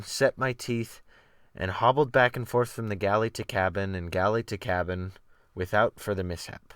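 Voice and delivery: ordinary delivery, nothing marked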